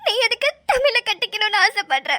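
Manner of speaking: crying through a painful retelling; upset, weepy, gulping sobs between phrases; sobbing. Acted. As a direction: repeat his words in tears. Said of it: "தேம்பி தேம்பி அழுதுனா".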